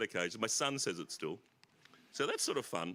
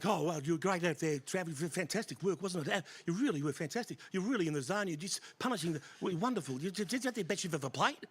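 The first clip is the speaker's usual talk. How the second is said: much higher, effusive interviewer voice; gushing commentator; 10 semitones higher than usual. Retold or imitated. Imitating Mark Taylor gushing at Travis Head, high effusive. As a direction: gush praise like a starstruck commentator.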